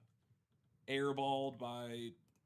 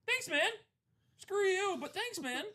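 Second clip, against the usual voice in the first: much higher, louder mock sarcastic thanks; 7 dB louder, slower than usual. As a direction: squeak out a sarcastic thank-you. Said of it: "I'm like".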